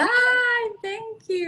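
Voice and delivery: high, touched "aww"; delighted thanks. Real, not acted. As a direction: gush with thanks at a compliment. Thanking for a compliment on espresso.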